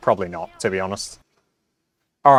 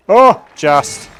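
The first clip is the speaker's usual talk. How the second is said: excited groan; 7 semitones higher, 10 dB louder than usual. Real, not acted. Shot barely carries the target.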